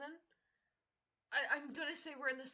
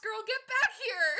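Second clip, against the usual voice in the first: calling out; 6 semitones higher, 8 dB louder than usual. Real, not acted. Yelling at escaping shiny.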